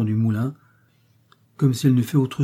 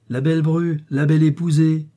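higher, louder mocking cry; scornful exclamation; 5 semitones higher, 5 dB louder than usual. A narrator's reading. Mother deriding the bride to her son, scornful exclamation. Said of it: "s'écria-t-elle".

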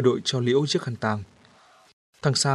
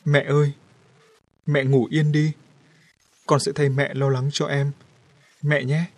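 tearful farewell; 3 semitones higher, 4 dB louder than usual. A narrator's reading. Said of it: "nước mắt rơi thánh thót".

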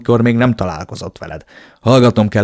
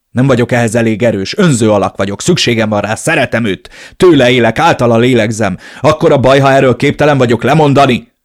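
desperate outburst; 2 semitones higher, 7 dB louder than usual. Acted. The narrator confesses his love to Elena in a loud, desperate rush. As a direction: pour it out, pleading and loud.

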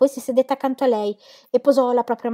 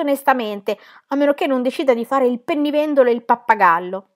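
higher and louder, bitter and heated; 5 semitones higher, 7 dB louder than usual. Acted. Will bitterly telling Dorotea he is too poor. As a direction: rail bitterly at your own prospects. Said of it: "disse Will con amarezza".

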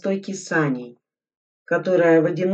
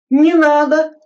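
high, loud, desperate plea; sobbing cry; 12 semitones higher, 10 dB louder than usual. Acted. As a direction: cry out begging through a sob. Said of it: "всхлипнув, закричал Крынкин".

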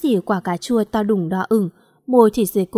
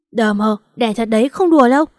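exasperated insistence; 5 dB louder than usual. Acted. Wife telling husband the labour is real, exasperated. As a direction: build to a loud, exasperated insistence.

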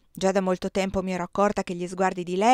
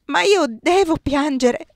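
higher, louder, slow tearful cry; sobbing; 7 semitones higher, 6 dB louder, slower than usual. A narrator's reading. Désirée tells Giulia she must cry, sobbing. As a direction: speak through tears.

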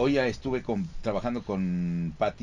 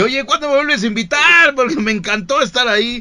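excited high voice; 13 semitones higher, 11 dB louder than usual. Retold or imitated. Imitating Lalo Garza.

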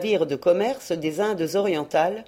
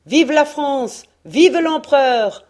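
higher, louder rallying cry, slowing at the end; crew shouting; 7 semitones higher, 7 dB louder, slower than usual. A narrator's reading. Cutter's crew hailing France and the emperor, loud rallying cry. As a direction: shout it like a whole crew cheering. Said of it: "l'équipage du cutter criait".

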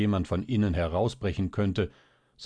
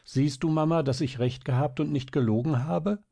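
put-on higher, excited child's voice; eager exclamation; 7 semitones higher than usual. A narrator's reading. Marie proving to her mother she told the truth, eager. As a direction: press the point eagerly. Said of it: "rief aber Marie schnell".

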